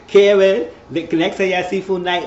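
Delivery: mock-demanding call to a friend; playful calling out. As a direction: call out loud and high. Calls out to Karen playfully.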